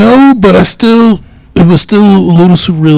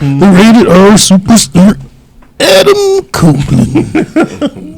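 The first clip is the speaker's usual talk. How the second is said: slow, drawn-out announcer voice, pitch soaring on the name; ring announcer; 5 semitones higher, much slower than usual. Pretend. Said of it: "make sure we get that right".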